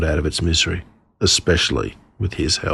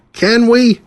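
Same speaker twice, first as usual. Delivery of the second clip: put-on pleading little girl; 3 dB louder than usual. Sister nagging Dad again.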